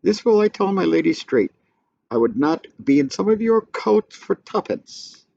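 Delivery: high-pitched mock jester; squeaky clown voice. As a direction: cackle it as a gleeful tattler. Fool runs off to tell.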